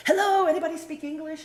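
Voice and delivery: high, loud appeal to anyone around; calling out. Retold or imitated. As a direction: re-enact a desperate call for help. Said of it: "I would go".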